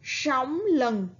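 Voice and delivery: sóng with a curled s, lừng with ưng; sóng lừng said carefully; reading along. Shown as a demonstration. Teacher reads it again for pupils. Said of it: "Các bạn hãy đọc lại nào".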